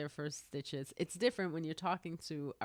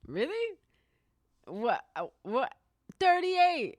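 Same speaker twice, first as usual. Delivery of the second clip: high, stammering disbelief rising to loud; retold astonishment; 10 semitones higher, 6 dB louder, slower than usual. Nurse hearing patient's age, retold astonishment. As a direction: sputter in shock, then burst out loud.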